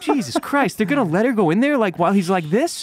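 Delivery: high-pitched retold disbelief; incredulous reaction. Retells own disbelief at the scene.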